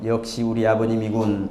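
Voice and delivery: low, softened voice of respect; quiet admiration. Acted. Third son won over by his father's bequest, quietly admiring.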